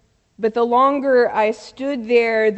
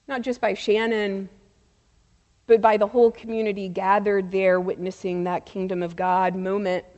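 usual speaking voice

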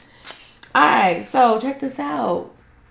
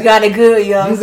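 loud mock old-timer; imitated old cat; 11 dB louder than usual. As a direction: echo the old cat's line, much louder. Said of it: "That's how them old cats".

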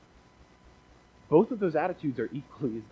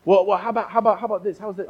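higher and louder, eagerly pushing for good news; eager prodding; 6 semitones higher, 8 dB louder, faster than usual. Retold or imitated. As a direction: bounce in trying to cheer him up. Cheery friend tries again.

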